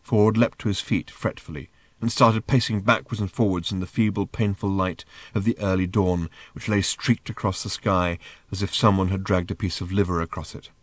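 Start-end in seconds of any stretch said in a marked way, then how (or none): none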